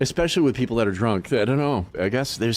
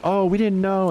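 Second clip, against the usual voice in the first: mock innocence; 9 semitones higher than usual. Mocking company's fake innocence.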